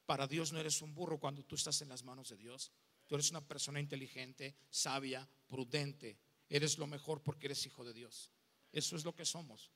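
tender reassurance. Real, not acted. Preacher assures the congregation they are God's children, softly and tenderly. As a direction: lay each word down gently like a blessing.

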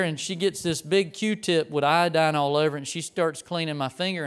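usual speaking voice

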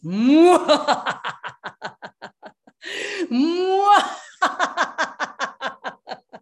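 loud, high evil villain laugh; mustache twirling villain. Shown as a demonstration. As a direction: cackle like a scheming villain. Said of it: "villainous laughter".

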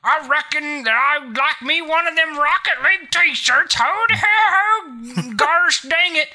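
high, loud hammed-up country yokel; a hillbilly in a fake mustache asking for a t-shirt; mustached cowpoke. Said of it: "big ol' handlebar mustache and be like".

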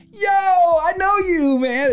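imagined fan. Pretend. Stranger spotting the host's sweatshirt: high, slow, stretched recognition call. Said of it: "I imagine that somebody's gonna go".

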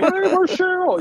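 high, lightly voiced fretting fan; mock worried viewer. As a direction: squeak out the fans' worried question. Host imitates worried viewers.

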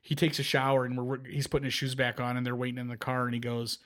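ordinary delivery

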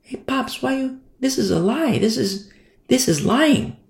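high, soft little-daughter voice; baby girl. Acted. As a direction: timidly question dad in a small high voice. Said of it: "Baby girl says".

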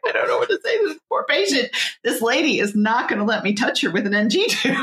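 high-pitched panicked resident, half laughing; mock freaking out. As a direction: imitate a rattled young doctor pleading on the phone. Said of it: "freaking out. His eyes bulged out".

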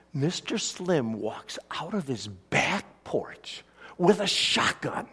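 usual speaking voice

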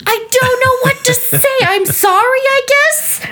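squeaky, helpless retold apology; flustered high voice. At a loss after roommate's joke, flustered high voice. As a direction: pitch it way up, at a loss.

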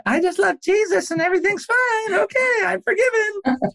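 hammed-up falsetto caricature of a kid claiming all is fine; mock naive. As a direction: parody a too-easy chirpy faith. Mocking a naive fake-forgiveness attitude.

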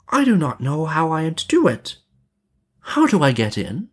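put-on high, questioning girl's voice; innocent little girl. A narrator's reading. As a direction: play innocent, pitched high. Gretel feigns ignorance.

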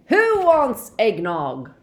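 loud, slow, high cheery call. A narrator's reading. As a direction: call it out slow to the whole room. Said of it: "asked Mister Weasley loudly".

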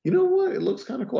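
excited inner thought. Retold or imitated.

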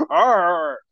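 high, throaty Arnold-style "Arr!"; strained wordless yell, imitating Arnold; Arnold accent yell. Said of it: "That's me doing an Arnold accent".

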